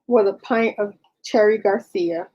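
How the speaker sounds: halting dictation, speaking the punctuation aloud; dictating. Real, not acted. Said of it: "hear me dictating".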